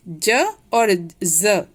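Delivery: first ज as j, second ज as z; contrasting ज sounds. Shown as a demonstration. Teacher contrasting two letters, ज as j then as z. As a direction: say ज slowly as j, then ज as buzzing z. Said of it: "दोनों में अंतर है".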